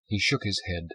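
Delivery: usual speaking voice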